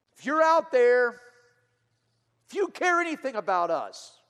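loud, pleading, desperate; desperate prayer. Retold or imitated. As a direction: cry out to the sky in desperation. Young man's desperate prayer retold.

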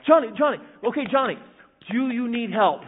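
loud, high urgent call. Retold or imitated. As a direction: call him anxiously. Lifeguard calling the boy.

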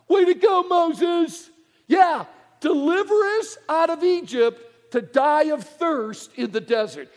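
high, loud mock-sarcastic griping; sarcastic complaint. Israelites mocking Moses for leading them to thirst, sarcastic shout. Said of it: "the people got upset".

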